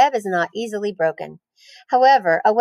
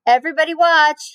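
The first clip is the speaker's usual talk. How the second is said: high, louder showy call; crying out; 8 semitones higher, 4 dB louder than usual. Acted. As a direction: shout for everyone to look. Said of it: "he cried".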